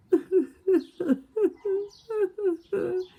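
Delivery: high, loud mock wailing boo-hoo; high wailing sob. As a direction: wail like a little lonely beaver. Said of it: "he began to cry".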